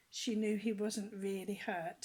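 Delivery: ordinary delivery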